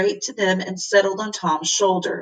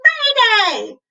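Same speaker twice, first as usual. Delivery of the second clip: shrieked. A narrator's reading. Wiffle bird warning everyone, shrieking. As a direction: screech it like a panicked bird. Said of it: "and then again".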